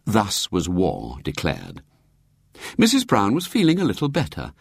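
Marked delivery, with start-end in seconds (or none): none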